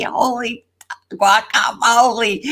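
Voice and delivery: ordinary talk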